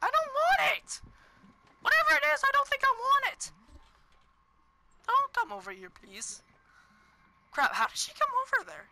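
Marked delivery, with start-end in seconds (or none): 0.0-6.4 high panicked protest. Real, not acted. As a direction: squeal it out in panic. Panicked at approaching enemy.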